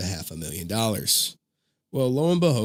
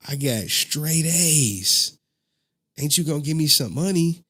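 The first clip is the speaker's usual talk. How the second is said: louder, higher, drawn-out boast; retold grandson fishing for a reward; expectant bragging; 5 semitones higher, 4 dB louder than usual. Grandson asking grandpa for money for straight A's, proud and expectant.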